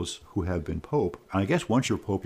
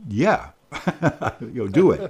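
laughing encouragement; 4 semitones higher, 4 dB louder than usual. Retold or imitated. Retold encouraging reply.

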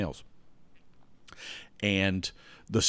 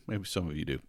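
softer, lower, falling concession; quiet aside; 3 semitones lower, 8 dB quieter than usual. Real, not acted. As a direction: drop it to a soft aside.

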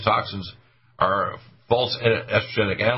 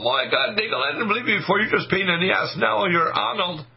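high, excited listener voice, dragging "Now you're Arnold"; converted listener; 9 semitones higher than usual. Retold or imitated. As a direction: play a fan who now believes only Arnold. Listeners won over by Arnold impression.

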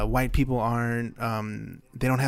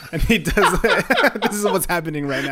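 giggling through the words; can barely talk for laughing; 7 semitones higher, 6 dB louder than usual. Real, not acted. Laughing through Office story.

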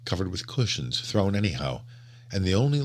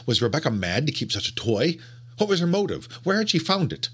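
agitated; 10 semitones higher than usual. A narrator's reading. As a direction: fire off the questions in alarm. Narrator's agitated questions.

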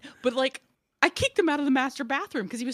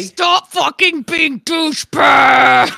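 loud, drawn-out scream; furious parent yell; 5 semitones higher, 13 dB louder, much slower than usual. Acted. Parent losing it at squabbling kids, furious scream. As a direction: explode at full volume, stretching the last word.

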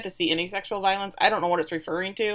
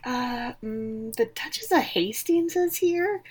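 timid servant; 4 semitones higher, slower than usual. Retold or imitated. Housekeeper timidly announcing a guest.

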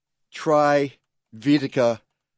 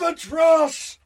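mock Monty Python shout. Shown as a demonstration. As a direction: bellow it like a street vendor. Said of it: "shouts the Monty Python call".

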